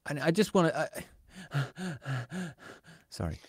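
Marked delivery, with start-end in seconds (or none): 1.7-2.5 heavy breath out mid-rant; sigh, catching yourself ranting; exasperated puff of breath; worked-up sigh. Real, not acted. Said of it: "I'm on a rant".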